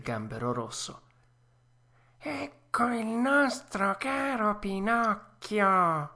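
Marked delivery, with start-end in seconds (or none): 2.2-6.1 high, slow, sly fox voice; a syrupy fox welcome; fox greeting. A narrator's reading. Fox greeting the puppet, a mild stagey cry. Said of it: "gridò la volpe".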